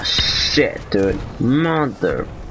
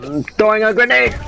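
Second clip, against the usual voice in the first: grenade warning yell; 9 semitones higher than usual. Real, not acted. Calling out grenade throw.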